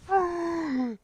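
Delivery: high, whining groan of disbelief; exasperated sigh. Real, not acted. Exasperated at the take.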